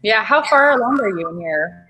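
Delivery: ordinary talk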